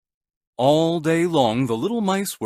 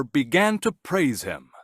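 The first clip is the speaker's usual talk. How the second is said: plain reading voice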